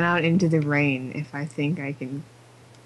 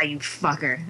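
ordinary talk, nothing marked

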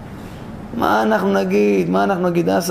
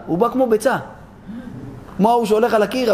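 ordinary delivery

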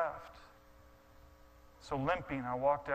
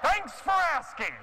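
high, loud exasperated confession shouted to the room; yelling out; 13 semitones higher, 7 dB louder than usual. Retold or imitated. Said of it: "I say and I yell out".

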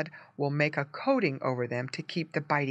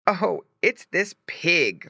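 laughing farmer; 3 semitones higher, 4 dB louder, slower than usual. A narrator's reading. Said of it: "laughed the farmer".

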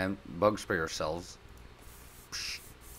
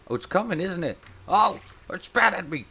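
higher and louder, startled; alarmed yelp; 10 semitones higher, 6 dB louder than usual. Real, not acted. Gamer attacked by a spitting bug, alarmed. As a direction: react as the creature spits at you.

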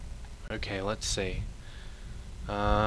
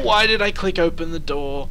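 usual speaking voice

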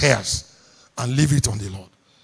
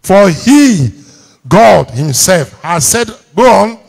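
loud, raised preacher's declamation; big, high, emphatic scripture reading; leading congregational reading; 4 semitones higher, 9 dB louder, slower than usual. Real, not acted. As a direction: proclaim the verse for a crowd to follow. Leads congregation reading verse aloud.

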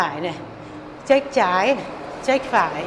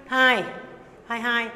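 ordinary talk, nothing marked

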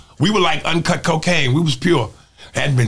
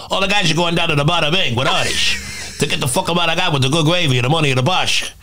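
mob boss impression with mushy "ish" sounds; Tony Soprano. Comic proves to interviewer he got the mobster, Tony Soprano voice. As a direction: run the words together like a TV mobster. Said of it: "it's like, you know".

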